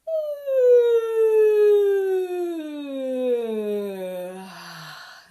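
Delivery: loud yawning sigh sliding down from high; big yawn. Shown as a demonstration. Voice teacher models a big yawn for students, loud yawning sigh. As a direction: open the soft palate and yawn out loud. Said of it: "raising the soft palate and a nice big yawn".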